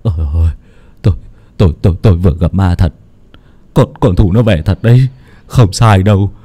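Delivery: stammering, shaken man's voice; a touch of panic, tripping over words; husband tells his wife he saw the ghost, stammering; trembling with fright. A narrator's reading. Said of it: "cất giọng run rẩy vì cơn khủng hoảng".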